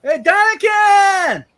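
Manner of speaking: long, high greeting shout; calling out. Real, not acted. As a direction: call out the name slowly. Greeting a viewer on stream.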